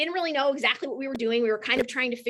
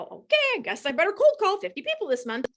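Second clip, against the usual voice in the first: high, fast, dutiful sing-song; mock obedient; 8 semitones higher than usual. Mocking a rep who obeys the leader's cold-call order, high and eager. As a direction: play the meek rep who just goes along with it. Mimicking obeying a team leader.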